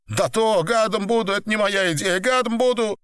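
high, pleading gangster voice; muttered protest. A narrator's reading. Pinned man pleads with boss, high muttered protest. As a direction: swear innocence in a high, tight voice. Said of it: "забормотал Апельсин".

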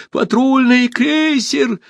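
very high, drawn-out call; alarmed cry. A narrator's reading. Someone in the hall warning everyone, high cry. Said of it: "крикнул кто-то в зале".

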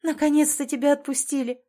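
high, overjoyed greeting; tearful happy cry of relief; squealing with joy. A narrator's reading. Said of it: "рыдающим и визжащим от счастья".

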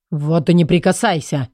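plain reading voice

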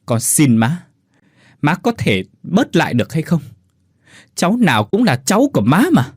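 high, quavering begging; pleading with mother-in-law; trembling plea. Acted. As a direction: beg her, voice shaking. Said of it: "run giọng bảo".

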